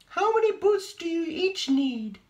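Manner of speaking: very high, loud fairy voice; high magical godmother. A narrator's reading. The hairy godmother appears, very high and loud.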